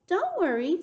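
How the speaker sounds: high, loud and eager; excited storybook kid; eager child character. A narrator's reading. Sweetie Pie reassures loudly in story.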